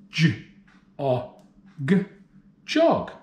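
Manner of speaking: segment J, o, g then Jog; sounding out words. Shown as a demonstration. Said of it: "Let's do it".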